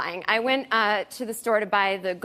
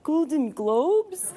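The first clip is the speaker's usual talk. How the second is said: put-on very slow, higher, vacant teen drawl; dopey teenager; 3 semitones higher, much slower than usual. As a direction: play the clueless sleepy clerk, stretched out slowly. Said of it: "he was so stupid".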